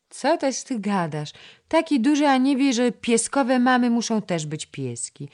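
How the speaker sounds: put-on a chattering little girl coaxing the boy to play; little girl Krysia. (wysoki głosik małej dziewczynki)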